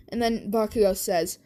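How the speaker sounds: ordinary talk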